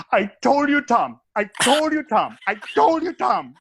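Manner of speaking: high, loud gloating told-you-so chant; gleeful valet. Retold or imitated. Valet gloats to Tom after the president joke, in a high excited chant. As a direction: crow it at Tom, over and over. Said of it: "one of the valets looks and goes".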